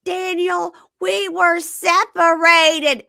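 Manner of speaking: high, drawn-out mock plea; mock pleading woman. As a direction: whine it loudly as the pleading fiancée. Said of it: "And she goes".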